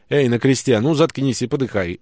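loud, harsh barked order; barking. Acted. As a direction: snap it out at the man on the cross. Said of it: "рявкнул легионер помоложе".

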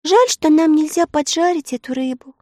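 high, put-on girl's voice; regretful little girl. A narrator's reading. Sister wishing they could fry fish, high regretful tone. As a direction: lament wistfully in a high child's voice. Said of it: "с сожалением промолвила Элли".

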